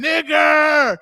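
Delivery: loud, high mock holler; imitated hollering. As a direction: holler it out, imitating a mom at home. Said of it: "all you hear is mom hollering".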